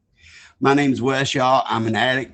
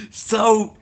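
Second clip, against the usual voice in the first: laughter; 8 semitones higher, 5 dB louder than usual. Real, not acted. Shared laugh.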